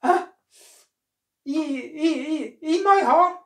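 gasp, then high, loud stammer; horrified cry. A narrator's reading. Monkey learning it will be eaten, horrified cry. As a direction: squeal it in alarm, tripping on the words. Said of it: "cried the monkey".

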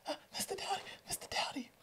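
imitating a frightened student's high, hushed whisper; scared whisper. Student hiding in a closet calls teacher, frightened whisper. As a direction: whisper urgently for help, very soft and high. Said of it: "why this student is whispering".

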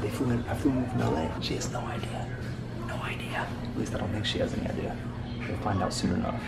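soft secretive whisper. Real, not acted. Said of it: "you wanna know a secret".

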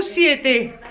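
ordinary talk

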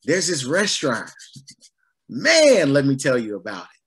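happy, hyped-up recommendation with a punched "man"; excited. Acted. Preacher playing a happy diner eager to share a restaurant, excited. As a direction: act out raving about a find. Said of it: "you look excited and you're happy".

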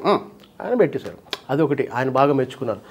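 normal talking voice

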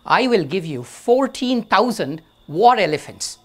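loud, eager boast blurted out; rash boast. Acted. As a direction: blurt the offer out loud and high without thinking. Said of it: "without thinking what he says".

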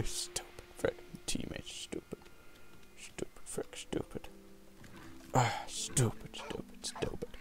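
very soft, unvoiced fuming; whispered grumbling. Real, not acted. Gamer to self after the team ran away, whispered grumbling. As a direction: curse your teammates under your breath.

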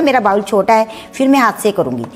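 usual speaking voice

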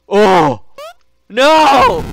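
hammed-up oh no; mock gasp. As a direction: fake horror at the mess. Mock dismay at breaking things.

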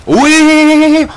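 high, loud panicked yelps; excited yelling. Real, not acted. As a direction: yell out in a frenzy. Screams while being attacked.

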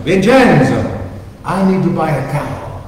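put-on calling out Vincenzo's name high in an Italian accent; mock yell. Luigi calls to Vincenzo across the farm, calling out in an Italian accent. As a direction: hail the farmer from afar, Italian accent. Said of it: "And he's yelling".

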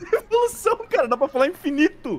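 very high laughing squeal. Real, not acted. Cracks up at the idea.

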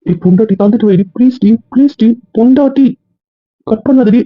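high-pitched begging; wheedling husband, pitch climbing; urgent pleading. Acted. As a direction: plead with the wife not to hang up. Husband begs wife before hang-up.

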